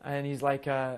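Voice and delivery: normal talking voice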